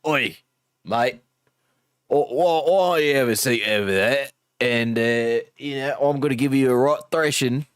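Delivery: cockney British. Retold or imitated. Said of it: "or I could do, like, a".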